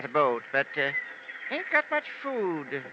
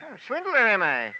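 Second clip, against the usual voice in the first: mock offense; 2 semitones higher, 4 dB louder than usual. Islander offended by insult.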